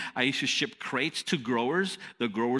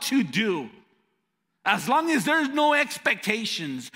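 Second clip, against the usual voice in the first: higher, hammed-up entitled worker; mocking those who want only fun; 6 semitones higher than usual. Mocking work-averse people.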